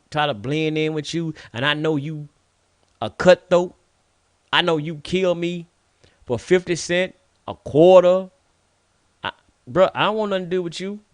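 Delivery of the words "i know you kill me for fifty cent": normal talking voice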